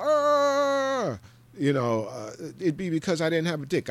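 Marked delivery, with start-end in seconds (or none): 0.0-1.2 high loud mock anguished yell; mock scream. The Thing running around, high loud mock scream. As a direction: howl it like a raging monster. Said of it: "I'd be running around screaming".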